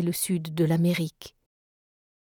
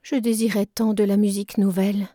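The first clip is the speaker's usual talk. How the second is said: usual speaking voice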